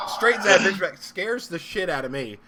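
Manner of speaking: ordinary delivery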